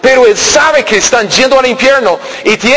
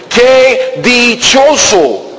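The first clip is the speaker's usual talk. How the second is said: slow, higher drawn-out exclaim; 4 semitones higher, much slower than usual. Retold or imitated. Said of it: "Yo digo".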